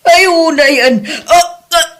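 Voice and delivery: put-on a mother pleading through tears with her child; tearful mother. Mother begging her child not to leave, crying.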